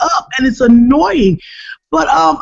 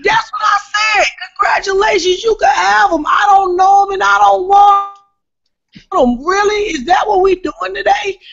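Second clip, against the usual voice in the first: high-pitched mock-indignant retort; retold exasperated outburst; 8 semitones higher than usual. Comic retelling her answer to a jealous wife, high-pitched exasperation. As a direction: squeal it back, imitating your own outrage.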